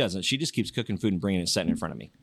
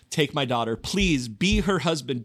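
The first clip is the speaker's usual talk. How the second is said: rising, louder pleading mother voice, much higher by the end; 13 semitones higher, 6 dB louder than usual. Retold or imitated. Host mother begging Sean to marry her daughter, rising plea. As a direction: beg him, climbing higher and louder.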